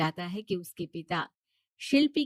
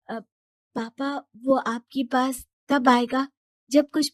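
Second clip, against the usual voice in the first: higher, louder hesitant pleading; Shilpi pleading; 5 semitones higher, 6 dB louder than usual. Acted. Daughter begging her father to wait, higher hesitant plea. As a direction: beg father for time, halting.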